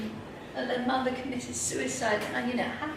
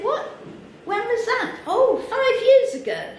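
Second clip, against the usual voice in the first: mock surprise; 12 semitones higher, 4 dB louder than usual. Surprised exchange with fan, acted.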